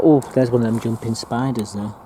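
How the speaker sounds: usual speaking voice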